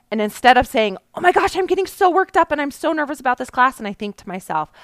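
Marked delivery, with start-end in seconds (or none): 1.1-3.8 higher and fast, an anxious rush; panicky self-talk. Retold or imitated. A teacher voicing her panicked thoughts before class, rushed and high.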